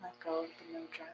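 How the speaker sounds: soft, unhurried meditation guidance; guided meditation. Acted. Yoga teacher guiding the class into calm, soft meditation voice. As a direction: ease the class gently into stillness.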